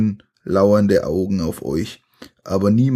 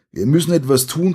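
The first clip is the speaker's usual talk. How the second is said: high, fast urgent cry; urgent shout; 7 semitones higher, faster than usual. A narrator's reading. Sandy waving the torch, fast high urgent cry. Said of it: "schreit Sandy".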